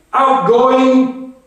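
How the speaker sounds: high, loud jokey shout of outgoing; shouted call. Retold or imitated. Soldier retelling leaving the outhouse, loud playful shout. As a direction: sing it out like a mock artillery call. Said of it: "we shouted".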